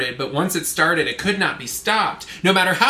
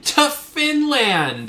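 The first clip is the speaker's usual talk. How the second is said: drawn-out, higher incredulous cry; mock outrage; 8 semitones higher, much slower than usual. Podcaster echoing friend's hockey complaint, in mock outrage. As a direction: stretch it out high in disbelief.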